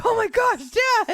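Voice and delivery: very high, loud mock shriek; outraged-style high yell, retold; startled daughter yelling. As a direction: imitate a teen shouting at dad after a scare.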